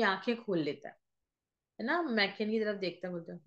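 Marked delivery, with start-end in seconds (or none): none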